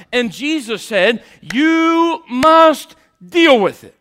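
high, loud, insistent sermon delivery; full-force preaching. Real, not acted. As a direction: build to a slow, shouted climax from the pulpit.

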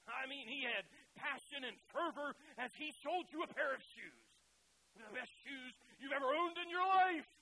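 booming, excited salesman pitch; pushy salesman. Retold or imitated. Preacher imitating a salesman, loud and hyped. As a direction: sell those shoes like a carnival barker.

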